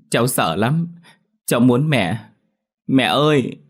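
put-on high, tearful little girl's voice; a scared child whimpering for mum; lightly voiced crying girl; frightened child. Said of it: "Dỗ mãi mà Tún chẳng nín".

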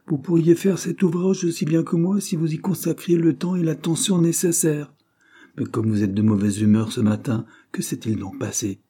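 prim senior clerk. A narrator's reading. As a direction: lecture the junior about the gloves. Senior clerk reasons with Cyril.

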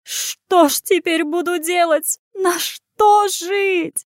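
high, slow, drawn-out howl; keening voice, stretched vowels; wailing innkeeper. A narrator's reading. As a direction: lament your ruin in long wails. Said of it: "трактирщица бегала вокруг пожарища и завывала".

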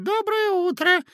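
a very high, thin squeak; tiny secretary squeaking good morning; squeaky greeting. A narrator's reading. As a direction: chirp it out like a toy. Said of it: "с писком".

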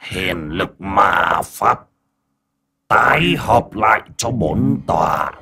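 very slow, stretched-out spell casting; drawn-out villain chant, building in force; incantation. Acted. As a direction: intone each word as a sorcerer's command. Demon elder chants a spell.

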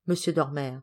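ordinary delivery

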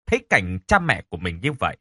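ordinary delivery, nothing marked

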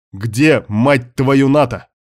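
furious demand, loud and sharp; angry shout. Acted. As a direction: bark out the question in rage. Demanding where Nata is.

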